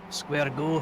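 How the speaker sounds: slow, put-on Scottish accent; light Scottish accent, slowed right down; Glasgow accent. Shown as a demonstration. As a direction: try your best Glaswegian, drawn out. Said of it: "best Scottish accent, or Glasgow accent".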